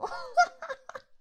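loud, high cackle; mocking laugh. Laughing at the whimpering partner, high and loud.

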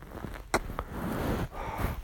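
long, airy exhale; breathy sigh; soft sigh. Acted. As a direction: let out a slow, quiet sigh. Comforting a crying partner.

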